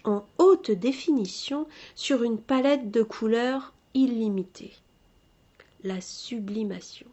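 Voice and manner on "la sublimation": "sublimation" whispered much softer; whispered term. Shown as a demonstration. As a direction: murmur "La sublimation" to yourself. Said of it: "repeats the term softly". ("La sublimation" chuchoté)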